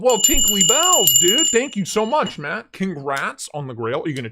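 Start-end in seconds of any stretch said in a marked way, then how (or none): 0.0-2.4 excited. Real, not acted. Thanking a gifter.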